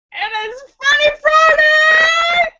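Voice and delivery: high, slow shouted hype; show announcement. Real, not acted. Host hyping the show name to viewers, high and loud.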